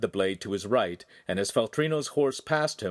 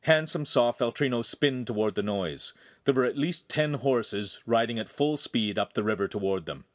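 plain reading voice

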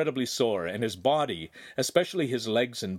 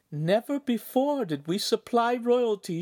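proud mother; 13 semitones higher than usual. A narrator's reading.

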